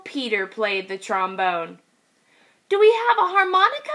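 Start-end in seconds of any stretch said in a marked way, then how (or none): 2.7-3.9 high, fast eager question; eager piglet. Acted. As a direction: rush the question like a kid. Kid asks quickly.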